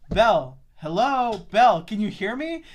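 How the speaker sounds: calling into phone. Retold or imitated. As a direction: check impatiently if the line works. Calling down a bad connection.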